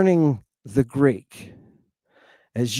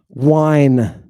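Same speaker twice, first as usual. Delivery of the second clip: ordinary delivery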